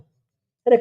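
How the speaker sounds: ordinary talk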